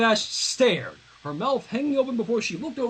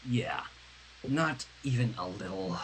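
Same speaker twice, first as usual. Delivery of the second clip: a softer, lower sighed reply; sighing; 9 semitones lower, 8 dB quieter than usual. Acted. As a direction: let it out on a tired sigh. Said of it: "Sunset said with a sigh".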